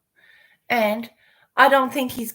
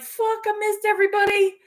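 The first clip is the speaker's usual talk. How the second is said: much higher, startled cry of regret; the penny drops, mock panic; dismayed realization; 13 semitones higher, 3 dB louder than usual. Rereading the chat after sleeping, high dismayed exclamation. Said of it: "I was like".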